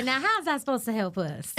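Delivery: high-pitched Southern twang on a character's line; Southern accent. Acted. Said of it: "I love that Southern accent".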